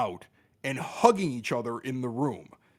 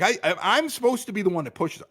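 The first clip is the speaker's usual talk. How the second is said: ordinary delivery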